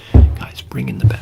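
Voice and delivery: loud, exasperated order to the crew; impatient call. Acted. Technician calls to the guys who had one job, impatient and loud.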